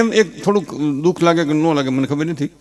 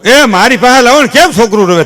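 put-on higher, louder old grandpa calling out; old grandfather; 7 semitones higher, 5 dB louder than usual. Grandfather demands the crying baby, higher and louder. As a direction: call out eagerly as the old grandfather. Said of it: "ઓલો grand father".

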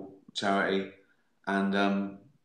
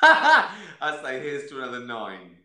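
slow, louder, laughing through the words; 4 semitones higher, 7 dB louder than usual. Real, not acted. Toasting fans while laughing at his own joke.

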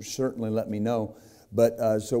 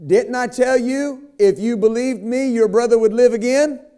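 mock Jesus rebuke; 13 semitones higher, 9 dB louder than usual. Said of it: "Actually, I got those two backwards".

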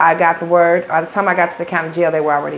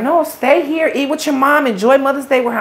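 his mother urging, voice pitched up high; pleading mother; 7 semitones higher than usual. Retold or imitated. Mother begging her to stay for Mother's Day, high pleading voice. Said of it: "she was like".

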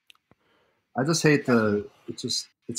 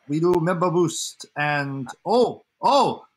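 mock streamer, pitch climbing on the exclamations; Carlos impression. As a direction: imitate an excitable streamer addressing chat. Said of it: "I can do a Carlos impression".

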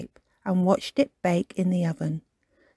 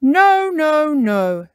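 Tiger correcting; 8 semitones higher, 5 dB louder, much slower than usual. Acted. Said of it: "said Tiger".

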